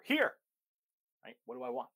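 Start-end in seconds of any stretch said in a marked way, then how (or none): 0.0-0.4 "Here" called out high and loud; called cue. Shown as a demonstration. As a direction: call the dog in. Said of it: "The more relaxed cue".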